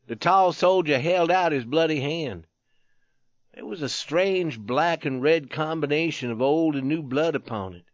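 plain reading voice

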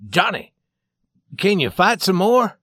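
urgent, anxious question; anxious father. A narrator's reading. Father anxious over beaten son.

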